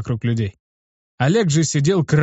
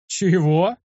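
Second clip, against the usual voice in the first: higher, rising question of disbelief; indignant; 5 semitones higher than usual. A narrator's reading. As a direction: take offence, pitch climbing at the end. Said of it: "возмутился я". (возмущённый вопрос)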